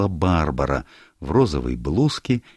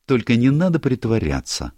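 higher, brighter-voiced girl, teasing; lively young woman; 8 semitones higher, 3 dB louder than usual. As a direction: play Barbara breezing into the room, higher and louder. Barbara voiced by narrator.

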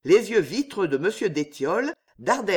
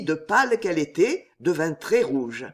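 ordinary delivery, nothing marked

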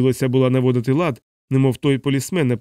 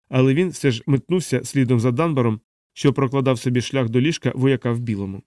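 ordinary delivery, nothing marked

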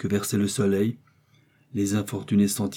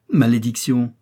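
louder, furious outburst; angry curse; 2 semitones higher, 6 dB louder than usual. A narrator's reading. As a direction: spit the curse out in rage. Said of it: "dit-il avec colère".

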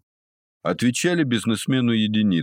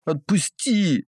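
high slow pinched-nose whimper; 11 semitones higher, slower than usual. Acted. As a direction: whimper it like a hurt puppy. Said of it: "заскулил Никита".

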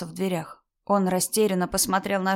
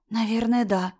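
ordinary delivery

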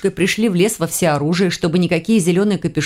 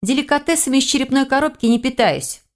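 haughty, higher-pitched pout; huffy; 7 semitones higher than usual. A narrator's reading. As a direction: sniff at it, mildly offended. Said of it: "надулась я".

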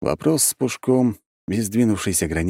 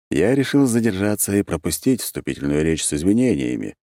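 ordinary delivery

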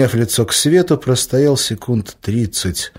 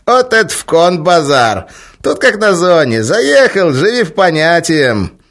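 monk voice; 9 semitones higher, 8 dB louder than usual. A narrator's reading. Monk speaking prison slang.

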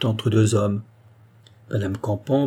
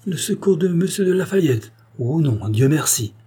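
high-pitched, scornful echo of the suggestion; repugnance; 6 semitones higher than usual. A narrator's reading. Queen rejects Lafayette's help to her maid, with repugnance. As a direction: recoil from the idea, voice pitched up at first. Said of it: "avec un accent de répugnance".

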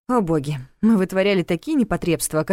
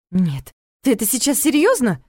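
indignant; 5 semitones higher than usual. A narrator's reading. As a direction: demand an answer, offended. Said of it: "возмутилась я".

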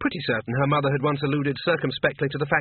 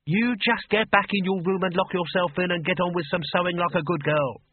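higher, scolding mum voice; a fussing mother sends her daughter upstairs; bossy old mother; 5 semitones higher than usual. Acted. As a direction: order her back to her room.

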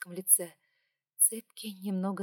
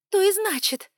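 loud, high furious shout; angry snap; 12 semitones higher, 12 dB louder than usual. Acted. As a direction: bark it back at him. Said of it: "heroine snaps, tearful".

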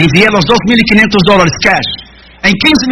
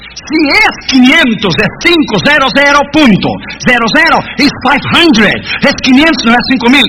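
quinientos stretched slowly and higher; patient emphasis; 4 semitones higher, slower than usual. Retold or imitated. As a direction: spell it out for a child one last time. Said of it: "Y le dije".

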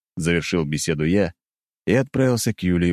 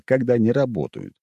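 ordinary delivery, nothing marked

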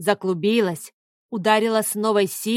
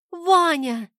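put-on a much higher, urgent girl's cry; 7 semitones higher than usual. A narrator's reading. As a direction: shout his name in alarm. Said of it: "крикнула Снежка".

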